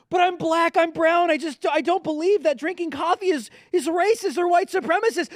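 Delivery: a lightly voiced defender, pleading his case.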